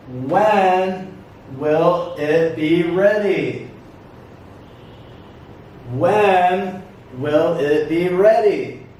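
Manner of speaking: slow, word-by-word question; measured classroom model for repetition; repeat-after-me model. Shown as a demonstration. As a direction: pause after "When", then ask it carefully. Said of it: "Repeat after me".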